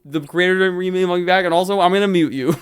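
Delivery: teasing mimic of a cocky guest; mocking Jamie. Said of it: "Jamie just went".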